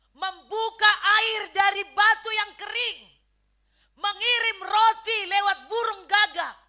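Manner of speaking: loud, high, impassioned preacher's declaration; booming exhortation with dramatic pauses; full-force preaching. Real, not acted. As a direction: proclaim it to the congregation, punching key phrases.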